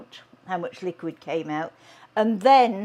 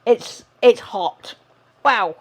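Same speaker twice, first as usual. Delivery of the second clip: burnt fingertips; 3 semitones higher, 9 dB louder, slower than usual. Real, not acted. Touching the hot potato.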